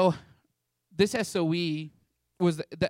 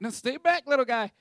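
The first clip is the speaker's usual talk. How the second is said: big protector; 7 semitones higher, 4 dB louder than usual. Retold or imitated. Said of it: "I'm like".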